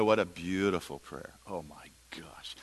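slow, hushed whisper; awed whisper. Real, not acted. Speaker moved by the beautiful prayer, awed whisper. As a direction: whisper it in amazement.

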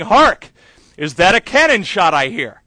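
loud, ringing theatrical delivery of the line; rehearsing a stage line. Retold or imitated. Actor rehearsing in the shower, loudly proclaiming. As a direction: belt it like a musketeer captain.

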